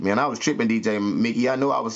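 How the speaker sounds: normal talking voice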